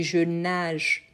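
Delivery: "nage" drawn out slowly, ending in a soft zh; slow "je nage", g like French j; soft j sound. Shown as a demonstration. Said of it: "Il a le son de j".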